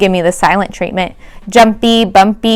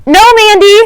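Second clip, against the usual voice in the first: much higher, louder alarmed warning; warning cry; 12 semitones higher, 5 dB louder than usual. Acted. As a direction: stop her before she grabs the frog. Teacher warns Mandy.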